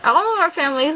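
ordinary talk, nothing marked

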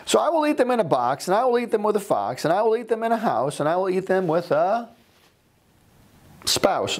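high, bouncing rhyme read aloud; the grumpy old man raving about green eggs; gleeful storybook character. Acted. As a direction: ham up the joy of the new convert. Reading Seuss rhyme in character.

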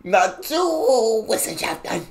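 mock German "Naturwissenschaften", high and drawn out on "Natur"; exaggerated emphasis. Reactor mimicking German video, "Naturwissenschaften" with high, drawn-out emphasis on "Natur". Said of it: "You gotta really emphasize parts of the word".

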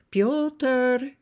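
high, loud call across a field; mother hollering for her child. Acted. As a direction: call the boy's name out far. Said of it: "calls to her little boy".

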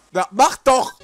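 usual speaking voice

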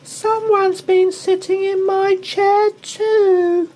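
very high, loud, tearful squeak; a tiny cub near tears; squeaky sobbing Baby Bear. Acted. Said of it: "sobbed Baby Bear".

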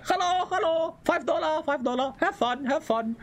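high, cheerful hawker's call; hammed-up imitation of a local ticket seller; jungle park vendor. Streamer mimicking the park guy selling entry, high and bright. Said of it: "and there's a guy".

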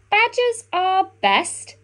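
slow, high, wondering voice; a slow high pondering beaver. A narrator's reading. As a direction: muse on it like a curious beaver. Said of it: "pondered Beaver".

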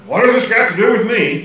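high, loud snotty retort. Shown as a demonstration. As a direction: push it back with snotty indignation. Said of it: "it sounds really snotty".

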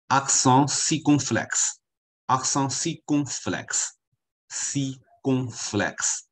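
teacher slowly modelling French for repetition; pronunciation demo. Shown as a demonstration. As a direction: draw out "circonflexe" syllable by syllable. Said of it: "不知道怎么念".

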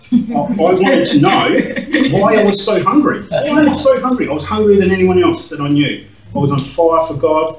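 loud and high, crying out the longing; fervent outcry. Real, not acted. A preacher testifying to the church about his hunger, a loud, high, fervent cry. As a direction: pour out the hunger with real fire.

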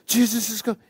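high, thrilled imitation; excited. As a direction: call it out high like an excited boy. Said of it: "he was excited".

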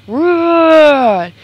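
big, high, loud triumphant roar. A narrator's reading. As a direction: roar it out like a victorious bear. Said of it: "let out a roar of triumph".

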